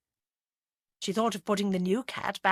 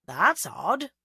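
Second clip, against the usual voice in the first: lightly voiced soft Gloucester accent; a hint of soft Gloucester accent; 3 semitones higher, 4 dB louder than usual. A narrator's reading. Bill on the phone to Agatha, soft Gloucester accent. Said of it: "in his soft Gloucester accent".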